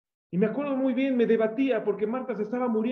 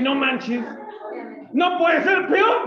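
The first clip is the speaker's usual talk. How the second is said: higher, louder, slow cry of disbelief; exasperated shout; 6 semitones higher, 6 dB louder, much slower than usual. Retold or imitated. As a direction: shout it like nothing could get worse. Retelling frustration, shouting. (grito exasperado, agudo y lento)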